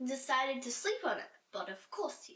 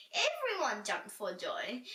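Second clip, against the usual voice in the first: ordinary delivery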